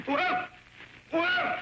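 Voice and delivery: high startled whoa as the fire flares; startled yelps. Acted. Man caught by his own fire, yelping high in alarm. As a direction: yelp in sudden alarm.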